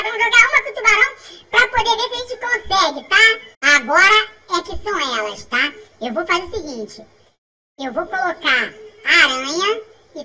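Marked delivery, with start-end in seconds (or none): none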